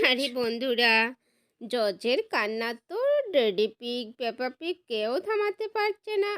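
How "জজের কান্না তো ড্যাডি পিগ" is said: plain reading voice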